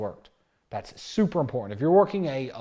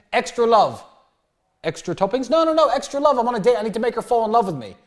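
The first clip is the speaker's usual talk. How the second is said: higher, louder and fast, laughing at himself; cheeky insistence, voice jumping up; pushy insistence; 8 semitones higher, 6 dB louder, faster than usual. Retold or imitated. To the drive-through worker, pushing a joke order, high and loud.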